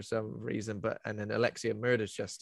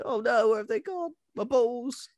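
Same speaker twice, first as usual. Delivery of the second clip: high, loud mock panic; 12 semitones higher, 6 dB louder than usual. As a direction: squeal it out in fake alarm. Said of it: "You'd be like".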